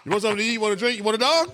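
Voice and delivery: high, rising offers stacked one after another; eager host pushing a dog on his guest, high and rising; mock eager host. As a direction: ham up the pushy host offering everything.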